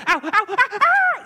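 mock a little boy's building scream; ow cries that climb in a laughing imitation; imitating a child's scream. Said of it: "progressive scream from Noah".

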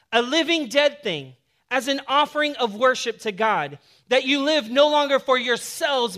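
loud, high, driving sermon delivery; full-force preaching. Acted. Pastor preaching to his church, loud and driving. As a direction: preach it big to the congregation.

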